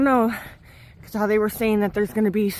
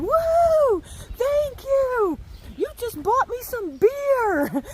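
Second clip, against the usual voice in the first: very high, drawn-out mock holler; retold whooping shout; 14 semitones higher, much slower than usual. Woman calls thanks to hiker who won her bet, whooping mock shout. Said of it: "I hear the woman up the trail shouting".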